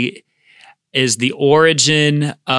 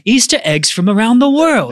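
mock-grand museum guide, pitched higher; museum curator voice; 10 semitones higher, 3 dB louder than usual. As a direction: announce it like a gallery curator. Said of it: "that was my museum curator voice".

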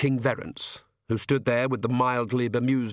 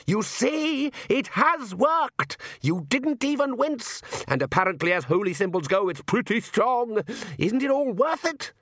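much higher, exultant character voice; gleeful triumph; 12 semitones higher than usual. A narrator's reading. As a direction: crow with delight, stretching the key words. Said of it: "very nearly danced a jig".

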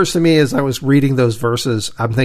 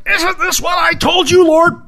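high furious shout; shaking fist shout; 14 semitones higher than usual. Retold or imitated. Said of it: "Jonah would be shaking his fist going".

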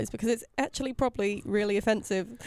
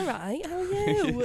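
drawn-out, higher-pitched silly greeting; slow, higher comic put-on voice; funny voice; 9 semitones higher, slower than usual. Disguising themselves to a caller they know, funny voice. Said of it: "Just put on a funny voice".

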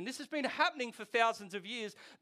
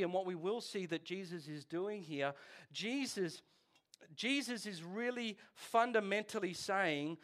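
ordinary talk, nothing marked

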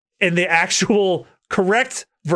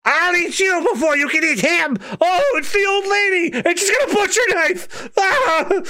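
high, shrieking critter voice; squeaky panicked Chopper; 12 semitones higher than usual. Acted. Chopper shrieks at the old lady.

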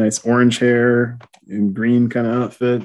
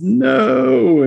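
psychic cry; 5 dB louder than usual. Retold or imitated. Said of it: "closes his eyes and says".

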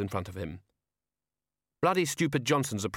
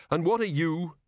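brusque demand; 7 semitones higher than usual. Acted. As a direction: bark it at the little gnome. Ridcully demands answer.

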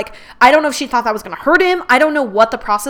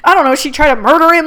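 rising, louder anxious rush of guesses; anxious panic; 5 semitones higher, 4 dB louder than usual. Retold or imitated. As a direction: spiral into worried speculation. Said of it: "I got anxiety now".

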